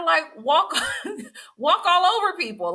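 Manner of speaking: high, laughing through the words; high laughing aside. Real, not acted. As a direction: break into a laugh mid-phrase.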